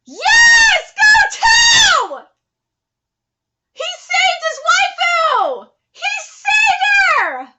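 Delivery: screaming high and loud, words stretched; ecstatic cheering. Real, not acted. Viewer cheers Tao saving his waifu, ecstatic shouting. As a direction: whoop like a fan at a winning goal.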